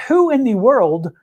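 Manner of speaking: ordinary delivery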